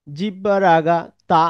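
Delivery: ordinary talk, nothing marked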